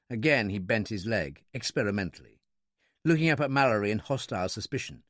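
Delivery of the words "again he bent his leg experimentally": ordinary delivery, nothing marked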